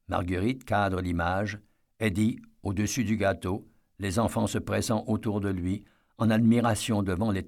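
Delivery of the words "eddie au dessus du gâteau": usual speaking voice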